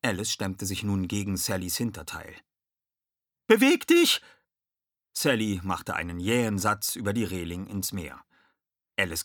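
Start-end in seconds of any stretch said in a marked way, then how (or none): 3.5-4.2 very high, loud yell; urgent high-pitched order; shouted command. A narrator's reading. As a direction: yell while shoving someone along. Alice shoves Sally towards the railing.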